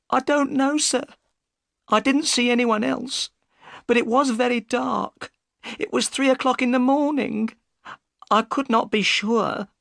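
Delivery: high-pitched upset woman; Missus Smith, tearful. A narrator's reading. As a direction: answer as a distressed woman, very high.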